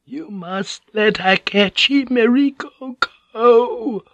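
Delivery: put-on frail old woman, slow and high; old woman whispering. A narrator's reading. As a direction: breathe it out as a dying old woman. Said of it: "the old woman whispered".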